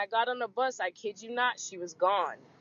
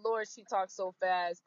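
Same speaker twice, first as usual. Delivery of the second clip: ordinary delivery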